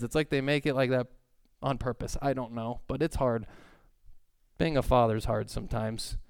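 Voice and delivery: ordinary talk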